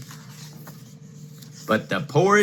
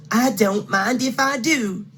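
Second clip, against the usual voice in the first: Goldilocks voice; 10 semitones higher than usual. Acted.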